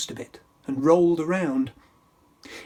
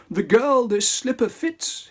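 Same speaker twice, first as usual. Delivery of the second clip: higher, louder royal proclamation; louder hammy decree; prince crying out; 6 semitones higher, 4 dB louder than usual. Acted. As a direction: announce it grandly. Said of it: "he cried".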